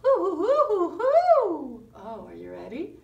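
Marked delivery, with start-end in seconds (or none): 0.0-1.8 high, loud hooting; sung owl hoo for the children. Acted. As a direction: hoot it like a baby owl. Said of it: "sounding just like Baby Owl".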